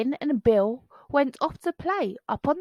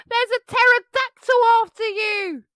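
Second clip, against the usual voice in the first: alarmed shout; 12 semitones higher, 7 dB louder than usual. Acted. Bonehead warning friends.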